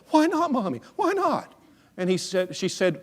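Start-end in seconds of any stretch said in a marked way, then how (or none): 0.1-1.5 put-on very high, squealed child's protest; imitated child's high-pitched dismay; upset little boy. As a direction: demand it like a whining four-year-old. Upset boy questions his mother.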